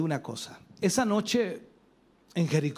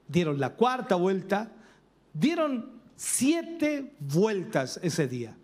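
usual speaking voice

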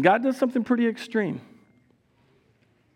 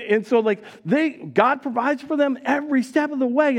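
ordinary delivery

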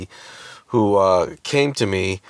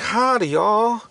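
high hammed-up Southern accent; 11 semitones higher, slower than usual. Husband exaggerates the donut lady, hammed-up Southern accent. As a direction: overplay a Southern howdy. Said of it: "doing an impression of her, uh, accent".

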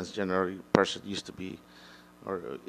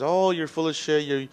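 higher, louder mock outrage at a sexist; angry critic; 6 semitones higher, 5 dB louder than usual. Imitating a critic who calls him sexist, mock angry. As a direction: imitate an angry critic calling you a pig. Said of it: "somebody out there says".

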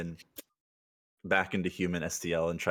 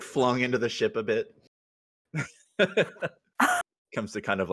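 normal talking voice

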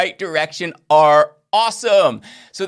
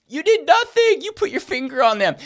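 mocking objection; 6 semitones higher, 3 dB louder than usual. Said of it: "you might be saying".

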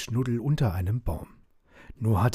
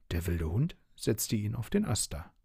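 plain reading voice

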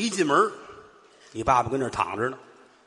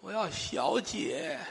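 put-on 1 feeble old man; 5 semitones higher, 9 dB quieter, much slower than usual. 模仿病危老头说话.

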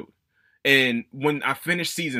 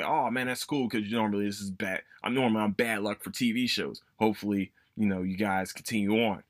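holding back excitement; 5 semitones lower, 5 dB quieter than usual. Retold or imitated. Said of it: "I didn't wanna show that excitement".

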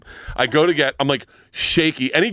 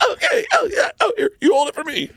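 laughing mock reply. Acting out the reply.

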